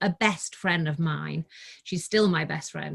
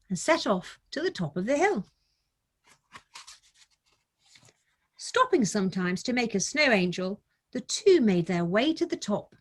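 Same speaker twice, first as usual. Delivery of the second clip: put-on gentle, higher storybook reading voice for children; story narration; 4 semitones higher than usual. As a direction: read it like a bedtime picture book, unhurried. Reads picture book aloud.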